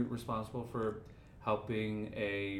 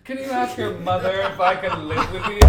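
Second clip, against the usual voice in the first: loud, high laughing plea; overdone comic request; mock pleading customer; 6 semitones higher, 12 dB louder than usual. Mock plea to shop clerk.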